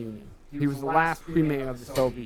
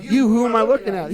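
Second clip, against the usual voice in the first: loud mock retort; 8 semitones higher, 7 dB louder than usual. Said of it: "She's like".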